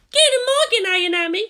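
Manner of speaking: sweet and pushy schoolgirl greeting; Asuka's voice. A narrator's reading. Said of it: "So she spoke".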